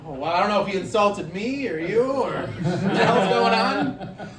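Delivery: ordinary talk, nothing marked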